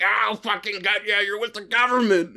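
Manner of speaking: high, put-on crank voice; ranting goblin man. Goblin man threatening the passerby walking off, high mock rant. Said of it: "And he's just like".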